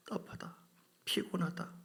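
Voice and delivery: hushed, sighing weariness; weary, stifled. Acted. Preacher voicing God grieving inside the believer, hushed and weary. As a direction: drop to a tired near-whisper. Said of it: "이렇게".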